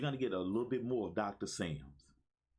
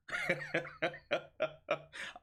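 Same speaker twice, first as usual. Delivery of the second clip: long, higher-pitched chuckle; excited laugh; 5 semitones higher than usual. Real, not acted. Laughs after declaring excitement.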